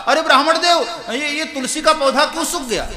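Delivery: put-on loud, jeering neighbour teasing the childless priest; taunting neighbour. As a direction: needle him like a nosy neighbour. Mocking neighbour taunts brahmin.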